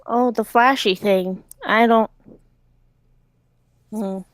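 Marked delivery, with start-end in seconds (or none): none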